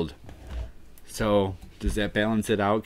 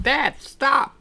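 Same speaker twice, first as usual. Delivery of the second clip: put-on high-pitched, louder baby yelling stop; squeaky baby protest; 9 dB louder than usual. Streamer voices the baby in a joke, high squeaky yell. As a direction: squeal it like a tiny indignant baby. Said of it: "the baby will like it and just be like".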